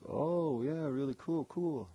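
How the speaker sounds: high, bright-eyed friendly rocker; super friendly. Retold or imitated. [name] greeting the new guy at the door, high and friendly. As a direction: gush like a laid-back star meeting a fan. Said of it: "You know, super friendly".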